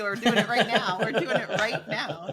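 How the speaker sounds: loud, through laughter; laughing. Real, not acted. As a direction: say it while cracking up, loudly. Joking overlap about the podcast.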